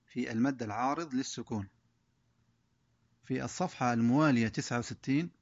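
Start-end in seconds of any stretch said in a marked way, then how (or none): none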